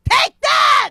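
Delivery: high, loud yell of victory; triumphant shout. Retold or imitated. She retells yelling at her fleeing ex, high triumphant shout. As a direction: bellow it like you just won the fight. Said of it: "I was like".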